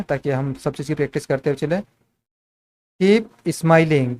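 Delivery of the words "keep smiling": each word stretched out slowly for the class; slow dictation. Shown as a demonstration. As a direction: spell it out slowly: "Keep smiling" with long drawn vowels. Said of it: "keep smiling क्या हो जाएगा". ("Keep smiling" बहुत धीरे, खींचकर बोलें)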